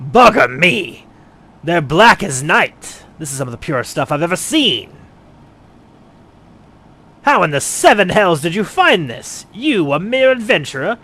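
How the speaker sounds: loud, high, shocked cry; stunned by the quality; astonished outburst. Acted. Astonished at pure obsidian.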